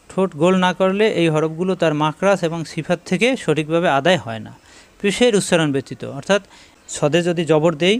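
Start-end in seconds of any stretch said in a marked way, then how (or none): none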